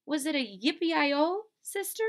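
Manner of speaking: high little-sister voice, questioning; Baby Lincoln. Acted. Little sister asking in story.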